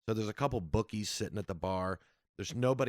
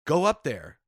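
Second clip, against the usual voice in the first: retold manager's raised-voice command; firm order; 5 semitones higher, 7 dB louder than usual. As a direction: push him toward the stage. Manager ordering him onstage.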